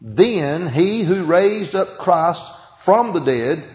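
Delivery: slow, deliberate scripture reading. Shown as a demonstration. Pastor reading Romans slowly to the congregation, deliberate. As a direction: read each word slowly so they listen. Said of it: "I'll read it slow".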